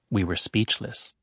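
ordinary delivery

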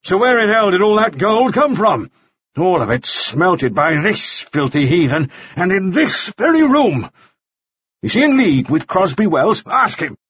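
high-pitched, heated demand; angry interrogation. A narrator's reading. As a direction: demand answers, voice pitched right up. Shouting interrogator rants at gunpoint.

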